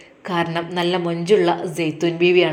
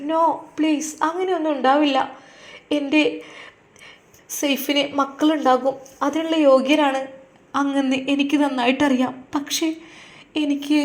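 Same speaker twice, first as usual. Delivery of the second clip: higher, earnest wife begging him; pleading wife; 5 semitones higher than usual. Acted. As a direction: plead with your husband not to worry.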